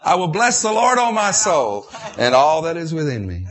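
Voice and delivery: loud, high exultant praise; declaring the psalm with full force; proclaiming scripture. Acted. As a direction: lift it up and declare it.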